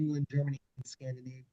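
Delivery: choppy call audio, words cutting out; breaking up. Real, not acted. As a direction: voice dropping out on a bad connection. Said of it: "You're breaking up just a little bit".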